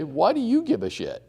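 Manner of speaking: ordinary delivery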